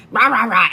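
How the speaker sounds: loud grumbling rah rah rah; frustrated ranting noise. Retold or imitated. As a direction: vent it like a frustrated rant.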